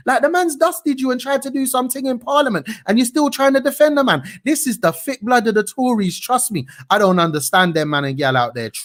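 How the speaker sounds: ordinary delivery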